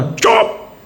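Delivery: put-on high, sharp hush at a baby; scolding a baby. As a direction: snap "चुप" high at the baby. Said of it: "उसको डांटोगे ना".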